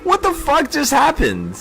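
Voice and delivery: very high-pitched astonished question; real shocked, soaring voice; stunned disbelief. Real, not acted. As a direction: squeal it in total disbelief. Stunned by sudden trading profit.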